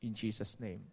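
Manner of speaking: very soft, low prayer voice; hushed, low-pitched prayer; closing prayer. Real, not acted. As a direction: pray it quietly and low, winding down to the amen. Closes an opening prayer.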